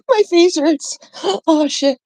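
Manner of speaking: squeaky high words through helpless laughter; laughing. Real, not acted. Streamer begging chat to keep the name, high helpless laughter. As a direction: laugh so hard the voice climbs and breaks. Cracking up over account name.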